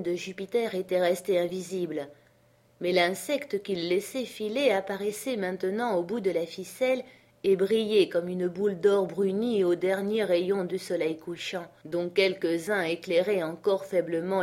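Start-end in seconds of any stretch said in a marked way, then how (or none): none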